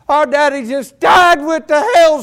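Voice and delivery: high, loud shocked cry; a son screaming out the news; preacher voicing a son screaming to his brothers.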